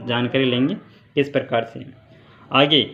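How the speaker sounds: ordinary delivery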